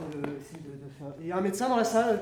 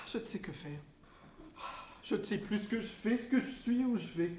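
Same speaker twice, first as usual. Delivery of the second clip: despairing; 7 dB quieter than usual. Acted. Despairing monologue.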